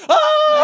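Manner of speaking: high, loud scream; mock puppet shriek with each swing; hammed-up piercing yell; Fats. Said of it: "screaming like Fats".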